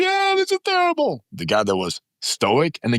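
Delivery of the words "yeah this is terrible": high mock panicked whine; panicky crazy guy. Recapping the panicked crosser to the host, high mock whine. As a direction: whine it like the one who hated every second. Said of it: "The crazy".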